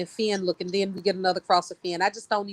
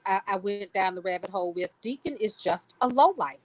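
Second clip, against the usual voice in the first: ordinary talk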